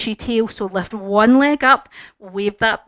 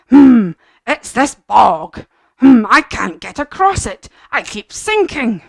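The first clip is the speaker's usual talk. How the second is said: stuck dog; 7 dB louder than usual. Acted. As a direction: moan it like a dog stuck in mud. Stuck dog voiced in story.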